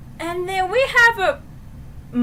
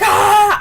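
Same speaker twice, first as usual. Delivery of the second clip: loud mock scream; imitated character's scream; 6 semitones higher, 11 dB louder than usual. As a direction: yell like Adrian in a panic. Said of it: "Adrian's like".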